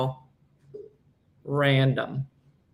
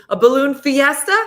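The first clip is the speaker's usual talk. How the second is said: higher and louder, delighted; excited exclamation; 8 semitones higher, 8 dB louder than usual. Real, not acted. Telling cohosts about Albuquerque, higher and louder with excitement. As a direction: sell the word fiesta.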